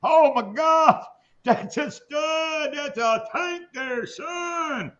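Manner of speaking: high, slow, gushing over a fish; excited fishing buddies. Retold or imitated. Joking about buddies gushing over a bass, slow high squeal. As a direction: squeal over the big bass like a proud buddy. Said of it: "start going".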